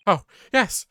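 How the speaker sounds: very high, loud, overexcited student; freaked-out, high-pitched voice. Acted. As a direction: cry out in frantic, squealing gratitude. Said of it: "kind of freaked out, high-pitched voice".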